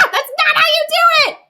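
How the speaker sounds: very high, loud screamed line; full-force acted outburst; angry shout. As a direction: scream it like a furious storybook girl. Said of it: "She was angry".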